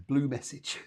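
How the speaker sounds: ordinary talk, nothing marked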